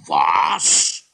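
loud startled yelp; outraged disbelief; king's shocked outburst; shocked cry. Acted. King shocked about cellar.

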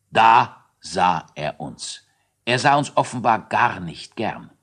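ordinary delivery

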